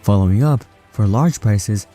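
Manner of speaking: ordinary delivery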